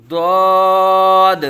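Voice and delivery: loud, stretched ضاد, thick and heavy; full-mouthed Arabic Daad. Shown as a demonstration. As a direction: hold a rounded ضاد on a level tone. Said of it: "اس کو پر پڑھیں گے".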